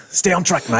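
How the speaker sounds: put-on Aussie accent; Australian accent. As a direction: scold yourself in mock Australian. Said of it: "gonna hear my Australian accent".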